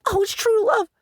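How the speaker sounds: high, whiny mock fan voice. Vlogger mocking the defensive fans in a high whine. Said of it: "the little lolly lovers going".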